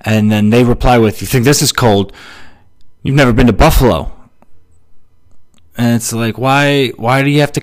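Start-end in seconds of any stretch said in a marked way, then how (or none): 1.2-4.1 mock dismissive retort, growing louder and quicker; scoffing one-upper. Stranger one-upping a remark about the cold, scoffing. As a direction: top them with a hammed-up scoff.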